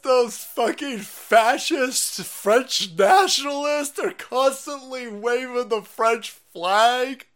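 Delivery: high, drawn-out, hammed-up whine; exaggerated whine building to a loud wail; mock whining plea. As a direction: complain in a slow high mocking voice. Mocks an offended complainer's plea.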